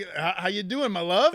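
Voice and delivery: high, loud flirty greeting; mock sweet-talk, voice jumping up; schmoozing.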